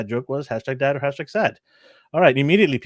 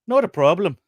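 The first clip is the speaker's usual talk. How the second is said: Irish doctor; 6 semitones higher, 5 dB louder than usual. Acted. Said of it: "Let me get my Irish accent out".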